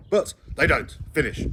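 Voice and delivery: firm snap to finish; sharp end. A narrator's reading. Mother ending argument.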